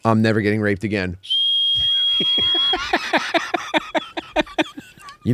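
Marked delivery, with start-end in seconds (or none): 1.2-4.8 wheezing laugh. Real, not acted. Wheezing laugh at own joke.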